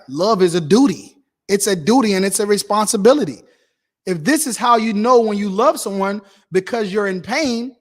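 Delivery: loud, pounding sermon lines; preaching hard. Real, not acted. Preacher telling the congregation love is duty, forceful preaching. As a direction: drive the point home from the pulpit.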